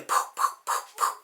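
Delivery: soft unvoiced poof; mock punching noises; whispered fight sound effects. Imitating her punches.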